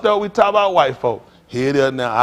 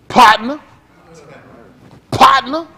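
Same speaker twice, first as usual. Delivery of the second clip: loud, rising call of "Partner"; 6 semitones higher, 7 dB louder, slower than usual. Real, not acted. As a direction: shout "Partner" out to the crowd. Preacher calling out key word.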